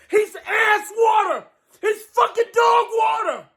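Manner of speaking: high, furious yelling at the quarterback; furious shout. Real, not acted. Fan raging at his quarterback, furious high shout. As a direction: scream it out, disgusted and enraged.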